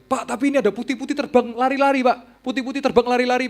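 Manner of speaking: high, urgent telling what she sees; alarmed report. Retold or imitated. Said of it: "Dia bilang". (laporan cemas dengan suara tinggi)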